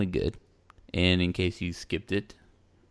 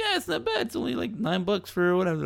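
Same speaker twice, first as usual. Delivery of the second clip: casual vendor voice; 13 semitones higher than usual. Retold or imitated. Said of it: "It's like".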